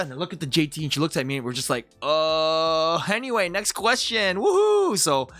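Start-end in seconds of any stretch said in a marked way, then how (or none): 2.0-4.9 higher, rising to a whoop; hammed-up flustered deflection; awkward cover-up. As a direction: brush past the slip with mock cheer. Retold flustered cover-up in class.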